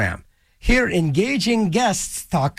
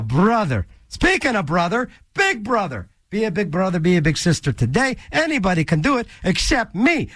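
ordinary talk, nothing marked

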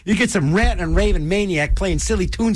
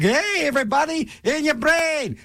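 high, mock on-air greeting; zany DJ call; 9 semitones higher than usual. DJ imitating his own zany sign-on greeting. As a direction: do his wild DJ hello. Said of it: "I can't be all like".

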